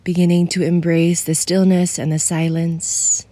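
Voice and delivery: soothing guide for someone feeling alone; guided meditation. Acted. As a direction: ease the listener into stillness.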